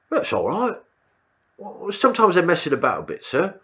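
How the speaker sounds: high, timid squaddie voice; a nervous young private answering his officer; meek soldier squeaking up to the colonel. Retold or imitated.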